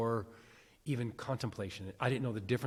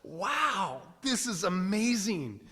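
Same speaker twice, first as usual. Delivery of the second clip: high, slowed mock awe; amazed; 8 semitones higher, 4 dB louder, slower than usual. Reliving the moment of being praised, in mock amazement. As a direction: gush in wide-eyed wonder. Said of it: "I was like".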